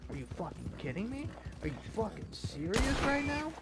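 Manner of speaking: high-pitched exasperated rant; losing it. Real, not acted. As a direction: rant in rising disbelief, voice pushed up high. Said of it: "I'm losing my shit over here".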